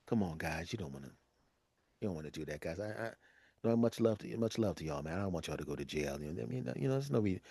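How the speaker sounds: hushed, soothing calm-down voice; soft pleading. Retold or imitated. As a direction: gently talk them down. Old head calming young men down.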